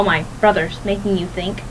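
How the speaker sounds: ordinary talk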